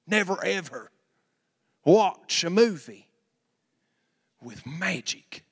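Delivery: a light parody of a severe preacher; strict preacher voice. Retold or imitated. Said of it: "I don't know why I said it in that voice".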